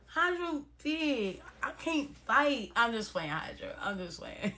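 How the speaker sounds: tiny, slow, hushed backing-down; playfully cowed, very soft, breaking into a laugh; meek mock fear. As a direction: shrink the voice as if intimidated. Jokingly scared of big Hydra.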